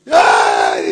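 loud comic wail; mock crying. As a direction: wail like a man crying to his psychiatrist. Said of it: "Va llorando donde el psiquiatra este hombre". (lamento llorón fingido)

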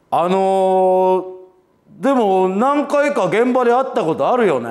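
an older star gently greeting a nervous young comedian, imitated; gentle star voice. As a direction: play the kind, unhurried star greeting the newcomer. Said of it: "手を包み込んでくれんねん".

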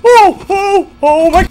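high breathless panic; panicked gasps. Real, not acted. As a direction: catch your breath after a fright.

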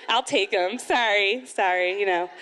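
usual speaking voice

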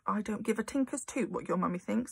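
ordinary delivery, nothing marked

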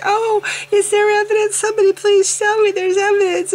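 high, pleading mock believer; mock desperate plea. Vlogger mocking Christians begging for evidence, high pleading voice. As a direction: beg for evidence in a high put-on voice.

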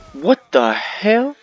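high, loud, slow bewildered cry; baffled outburst. Real, not acted. Confused at something odd in the game, slow and loud. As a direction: drag out the confusion.

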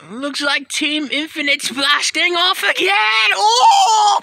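high, drawn-out cartoon villain yell; blasting-off shout. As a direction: shout it as you fly away, rising into a scream. Villains sent flying, crying out.

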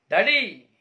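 loud, high call to his father; anguished cry. Acted. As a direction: cry out to your dad. Son crying out to father. (ಅಪ್ಪನನ್ನು ಜೋರಾಗಿ ಕೂಗುವ ಮಗ)

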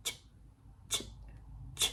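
"ch" alone as a tiny voiceless puff; short CH sound. Shown as a demonstration. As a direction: clench and puff out a quick "ch". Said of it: "พ่นเอาลมออกมาเลย". (กัดฟันพ่นลม "ch" สั้นๆ)

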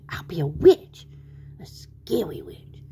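spooky whisper, drawn out at the end; conspiratorial whisper. Acted. Tweety planning his witch disguise, spooky whisper. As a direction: whisper it slowly as a scary plan.

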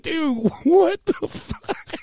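high, slow, laughing "what the fuck"; laughing disbelief. Retold or imitated. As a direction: laugh through the shock. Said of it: "I was like".

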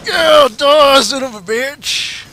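loud triumphant shout; roaring as he kills; triumphant yell. Real, not acted. Killing enemy in game.

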